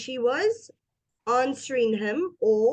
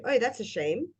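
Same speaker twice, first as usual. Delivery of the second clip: quick, indifferent sympathy; perfunctory reaction; uninterested; 4 dB quieter than usual. Shown as a demonstration. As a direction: toss it off as if you don't care. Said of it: "if we are interested or not".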